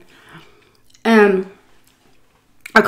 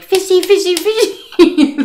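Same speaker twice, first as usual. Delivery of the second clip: put-on very high, loud childish fishy call; little girl calling to the fish; Finding Nemo girl; 13 semitones higher, 6 dB louder, slower than usual. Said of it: "that little girl ... is like".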